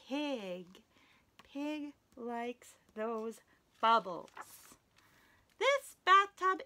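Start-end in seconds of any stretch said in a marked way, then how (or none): none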